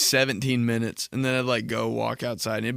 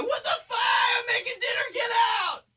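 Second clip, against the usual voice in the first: high mock shriek of a busy mom; mom yelling. Mom chasing her son out of the kitchen, high shriek. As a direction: shriek it like a mom cooking dinner. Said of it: "my mom and be like".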